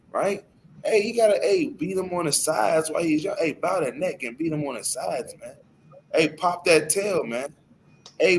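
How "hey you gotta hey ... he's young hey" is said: ordinary talk, nothing marked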